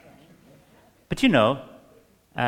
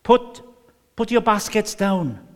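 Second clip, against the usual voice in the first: higher and louder friendly instruction; pastor's driver; 9 semitones higher, 4 dB louder than usual. Retold or imitated. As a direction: tell her to set the baskets down. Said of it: "he said".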